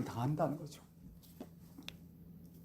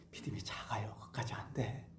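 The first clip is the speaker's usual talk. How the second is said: hushed, breathy confession to the congregation; whisper; 9 semitones lower, 18 dB quieter than usual. Real, not acted. Preacher confiding to the congregation that faith is small, whispered. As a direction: drop to a whisper as if sharing a secret.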